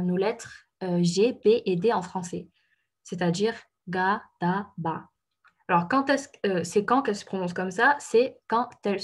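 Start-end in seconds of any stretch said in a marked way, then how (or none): 3.9-5.1 "ga, da, ba" with soft voiced g, d, b; soft unaspirated consonants. Shown as a demonstration. Teacher showing learners softened Korean consonants, soft and unaspirated. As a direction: say "ga, da, ba" gently voiced, no puff of air. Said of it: "sans expiration et juste douce".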